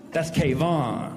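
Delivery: "Kayvon" low and drawn out; cranked-up ethnic name. Acted. Passenger correcting the TSA worker, "Kayvon" slow and grand. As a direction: stretch "Kayvon" slowly, stressing the last syllable. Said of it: "sometimes I crank it up, even more ethnic".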